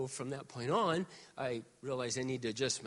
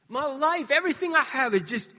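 rising preaching; 10 semitones higher, 7 dB louder than usual. Acted. Preaching climax.

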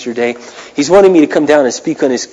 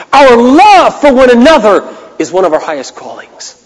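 higher and louder, the fiery pulpit voice; full-force preaching; 10 semitones higher, 6 dB louder than usual. Acted. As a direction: preach it out, slow and ringing on each line. Pastor preaching with fervour.

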